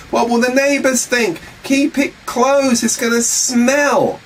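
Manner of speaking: high, worried, fussing voice; fussy Martha. Acted. Preacher voicing Martha, high and fretting about the smell. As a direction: squeal it out like an anxious sister. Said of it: "Martha, the sister of the dead man, said to him".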